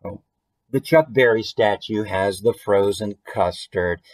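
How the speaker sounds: usual speaking voice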